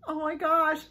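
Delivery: normal talking voice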